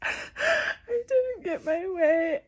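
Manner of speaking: slow, high crying wail on didn't get my way. Real, not acted. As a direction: let the tears take the words, drawn out. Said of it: "I'm little [name] and just throwing a tantrum".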